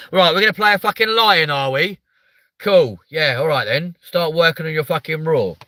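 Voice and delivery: excited. Real, not acted. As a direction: get pumped about the lion. Excited about playing a lion.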